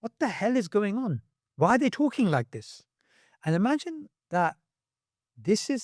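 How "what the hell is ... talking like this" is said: high-pitched, incredulous reaction; baffled disbelief. Acted. Reader reacting to that statement, baffled disbelief. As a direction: act out being appalled and baffled.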